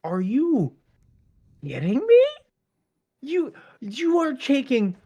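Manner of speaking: retelling his own angry outburst at the event planners; incredulous outrage. Retold or imitated. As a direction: hit the roof in disbelief. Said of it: "And I'm like".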